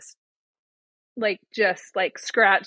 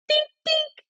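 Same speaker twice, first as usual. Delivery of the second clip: high-pitched "dink, dink"; imitated bouncing dinks. Mom mimicking son with the basketball, high-pitched dinks. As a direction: squeak out little ball noises.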